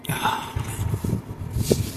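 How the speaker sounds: long unvoiced sigh, pausing to steady himself; real shaky sigh. Real, not acted. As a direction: let out a long breath to regain composure. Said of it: "Hold up".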